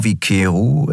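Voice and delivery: usual speaking voice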